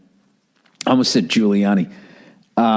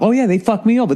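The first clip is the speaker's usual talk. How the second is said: much higher, whining like a cheated fan; mock whiny complainer; 11 semitones higher, faster than usual. Comic mimicking angry fans whining about badges. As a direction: whine it like you got ripped off. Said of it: "just us complaining".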